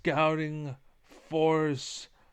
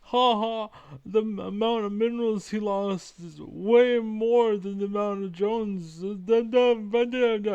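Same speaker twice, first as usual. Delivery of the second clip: high, loud mocking whine with fake laughter; mocking impression; 7 semitones higher, 5 dB louder than usual. Mimicking a rival gloating about wasted workers, high mocking voice. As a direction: sneer it out as a gloating know-it-all. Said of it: "and he's like".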